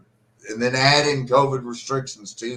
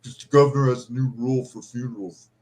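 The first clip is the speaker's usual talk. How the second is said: louder, higher snide bureaucrat voice; mocking official voice; 4 semitones higher, 6 dB louder than usual. Grieving families told funeral limits, mocking official voice. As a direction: mimic a smug official reading the rules. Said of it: "And they're like".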